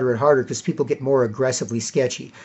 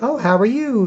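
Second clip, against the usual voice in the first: flirty; 8 semitones higher than usual. Retold or imitated.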